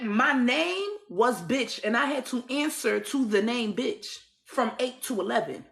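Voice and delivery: usual speaking voice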